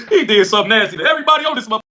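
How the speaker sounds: can't hold the laugh in. Real, not acted. Laughs through the line.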